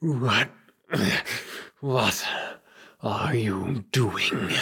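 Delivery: halting, drawn-out vampire menace; enraged vampire villain. Acted. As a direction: spit out the question in slow pieces. Game master voices vampire villain Miller.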